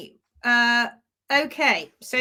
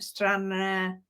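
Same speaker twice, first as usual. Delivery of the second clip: slow, lower Scottish-tinged place name; slightly Scottish accent; 5 semitones lower, 6 dB quieter than usual. Retold or imitated. Showing how she first read Stranraer, slightly Scottish accent. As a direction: say it slowly with a hint of Scottish. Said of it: "say it with a slightly Scottish accent".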